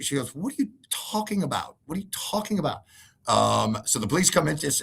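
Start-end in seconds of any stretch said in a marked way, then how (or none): 0.3-2.8 mock confused wife. Wife's confused reply.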